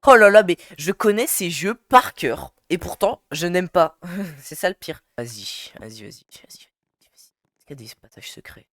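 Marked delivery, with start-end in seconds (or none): 5.2-8.7 very quiet, near whisper; hushed muttering. Real, not acted. Gamer searching for secret passages, hushed muttering. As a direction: keep it under your breath, low and secretive. Sneaking about in the game.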